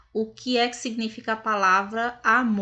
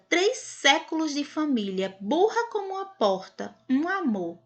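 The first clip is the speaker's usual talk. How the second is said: ironic praise, pitch climbing high on burra; sweetly sarcastic read, mocking the girl; 5 semitones higher than usual. Shown as a demonstration. Teacher reads the quote to class, ironic. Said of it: "Então, aí foi usada uma ironia".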